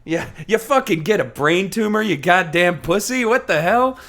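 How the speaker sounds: mock tough-guy scorn; mock outrage. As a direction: sneer it like a callous tough guy. Mocking Holly's attitude.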